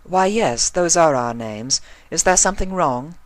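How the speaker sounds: high, refined lady voice; prim unicorn, politely puzzled; Rarity's voice. A narrator's reading.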